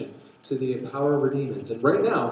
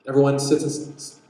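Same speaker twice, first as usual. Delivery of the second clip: normal talking voice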